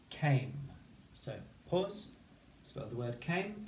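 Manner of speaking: ordinary talk, nothing marked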